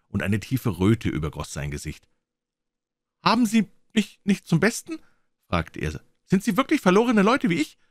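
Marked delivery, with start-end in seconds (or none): 3.2-5.0 put-on high, hesitant young man's question; unsicherer junger Mann. Young man unsure, suspects mockery. 6.3-7.8 put-on high, urgent young man's question; unsicherer junger Mann. Young man anxiously questions strangers.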